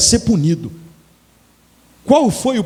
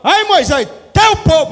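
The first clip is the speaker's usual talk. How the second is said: high exasperated shout at Moses; God's angry shout; 10 semitones higher, 3 dB louder than usual. Acted. God shouting at Moses over the people, high exasperated shout. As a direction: cry out as God fed up with his people.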